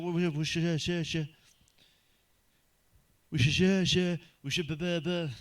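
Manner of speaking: a lightly voiced drunk man stuck repeating and stammering. Pub man answering about wars, stammering drunkenly. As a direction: stumble over every word like a drunk. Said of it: "really drunk or he had a speech impediment".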